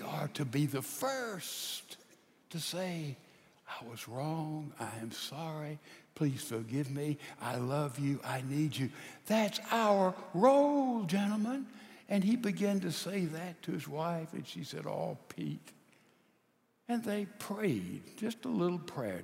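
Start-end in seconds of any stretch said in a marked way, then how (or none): 3.7-8.9 very soft, low confession; tender apology. Shown as a demonstration. Husbands first to apologise to their wives, tender apology. 14.9-15.7 low, hushed, melting reply; touched forgiveness. Acted. Wife answers her husband's apology, touched forgiveness.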